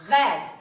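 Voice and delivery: "Red." with a French R from the back of the tongue, loud. Shown as a demonstration. Coach demonstrates the French R to the class, loud. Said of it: "a very classic French sound".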